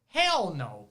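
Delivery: sudden loud outburst; loud exclamation. Real, not acted. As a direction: blurt it out loud. Rejecting sex out of obligation.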